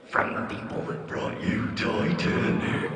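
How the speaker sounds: very slow, super deep preview announcer; deep movie-trailer voice. Acted. Speaker mimicking the guy from the previews, super deep voice. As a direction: draw it out in a huge deep trailer voice. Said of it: "that super deep voice he's got".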